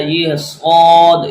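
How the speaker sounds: heavy, slow سود for the class; heavy letter. Shown as a demonstration. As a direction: stretch out سود with a full, heavy mouth. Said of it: "اس کو ہم نے پر پڑھنا ہے".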